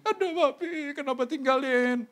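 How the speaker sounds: high, hammed-up widow's wail; mock wailing. Widow crying to dead husband, mock high wail. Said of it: "Udah mati baru nangis".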